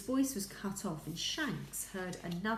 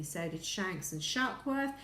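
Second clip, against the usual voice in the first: ordinary delivery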